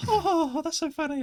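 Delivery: mock her giggling delight; imitating girlfriend. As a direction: girlfriend laughing at his story, high and giggly. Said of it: "Is that how she sounds?".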